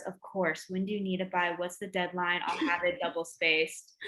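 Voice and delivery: obliging dad. Retold or imitated. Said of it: "I asked my dad".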